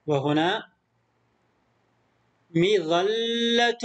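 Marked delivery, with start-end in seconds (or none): 2.6-3.8 مظلة read slowly with the doubled ل held long; teacher showing the shadda in مظلة; shadda stressed. Shown as a demonstration. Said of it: "لاحظوا التشديد هنا".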